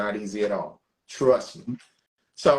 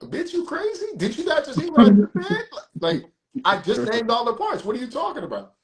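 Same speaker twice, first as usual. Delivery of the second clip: retold disbelief; 9 semitones higher, 3 dB louder than usual. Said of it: "I was like".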